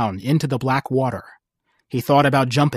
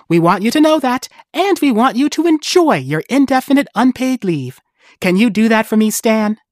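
bright; 9 semitones higher, 4 dB louder than usual. Acted. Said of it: "Lucy said brightly".

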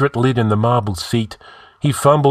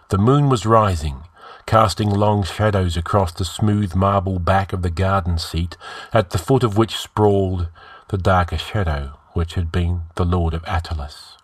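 ordinary delivery

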